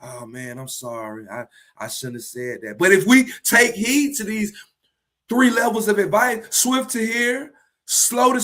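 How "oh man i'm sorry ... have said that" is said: low, very quiet regret; subdued, sheepish admission of fault; contrite apology. Acted. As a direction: apologize humbly, almost under your breath. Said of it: "go back and apologize".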